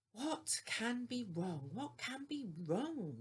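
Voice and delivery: fretting coaches repeating their worry; anxious. A narrator's reading. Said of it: "wondered the coaches anxiously".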